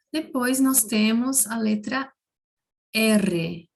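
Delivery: ordinary talk, nothing marked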